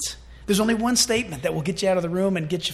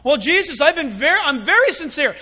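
pleading sinner; 6 semitones higher, 7 dB louder than usual. Acted. Sinner pleading at heaven's door.

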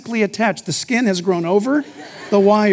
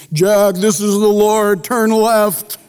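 slow, louder booming divine voice; voice of God; 4 dB louder, slower than usual. Pretend. Pastor mocking an audible God giving directions, slow booming voice. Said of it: "I've never heard".

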